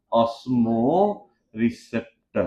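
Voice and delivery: Osmo receptor stretched out slowly, stress on osmo; slow dictation. Shown as a demonstration. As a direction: spell out osmo receptor for the class to write. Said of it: "लिख देता हूँ मैं osmo receptor".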